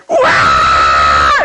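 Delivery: long low retold wail; howling wail. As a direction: let out a drawn-out cry. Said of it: "Elle se met à hurler". (long cri plaintif imité)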